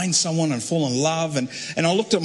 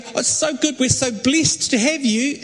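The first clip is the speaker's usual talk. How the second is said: put-on gushing girls; 7 semitones higher than usual. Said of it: "And they go".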